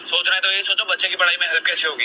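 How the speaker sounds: high-pitched, exasperated reproach; irritated scolding. Acted. Husband scolds wife over helping with studies, irritated. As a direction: take her to task.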